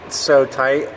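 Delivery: talking while lying on back; strained voice from under a car. Real, not acted. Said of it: "so I'm under the car".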